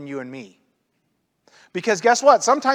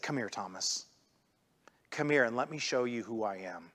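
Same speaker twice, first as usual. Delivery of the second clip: much softer, gentle invitation; patient and tender; 8 semitones lower, 13 dB quieter than usual. Retold or imitated. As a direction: coax him in quietly with love. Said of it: "Because Jesus loved him enough, and he was patient".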